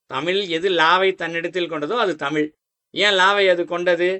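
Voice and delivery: ordinary delivery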